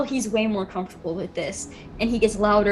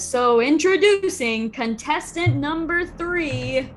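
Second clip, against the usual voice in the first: higher, drawn-out introduction; goblin announcer; 6 semitones higher, 3 dB louder, slower than usual. Acted. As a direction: stretch it out like an arena announcer. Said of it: "he says".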